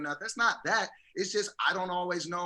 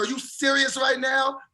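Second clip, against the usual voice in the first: raised, louder mock-indignant question; imitating her anger; 5 semitones higher, 5 dB louder than usual. Husband quotes his wife after his sorry, imitated anger. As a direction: retell her angry disbelief, higher and louder. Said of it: "then she gets mad".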